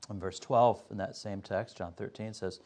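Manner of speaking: ordinary talk, nothing marked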